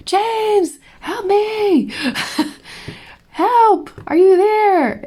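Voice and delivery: screaming for help. Retold or imitated. Stranded in the shower, calling husband James, retold scream.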